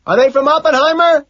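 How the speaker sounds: high, full-volume yell; loud holler; shouting. A narrator's reading. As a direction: shout the question over a din. Shouted exchange at a concert.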